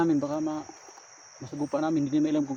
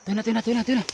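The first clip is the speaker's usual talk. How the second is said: urgent urging; 6 semitones higher, 7 dB louder, faster than usual. Real, not acted. Urging group to follow.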